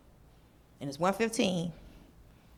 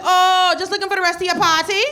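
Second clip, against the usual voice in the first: higher, louder cheery clerk voice; sweet customer-service lilt; chirpy receptionist; 7 semitones higher, 8 dB louder than usual. Retold or imitated. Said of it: "She's like".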